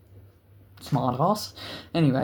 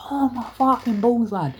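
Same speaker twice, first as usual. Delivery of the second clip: high, hammed-up groan of pain; retold pained cry; 8 semitones higher than usual. Mate hit in the balls falling back, pained. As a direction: imitate someone hit in the balls.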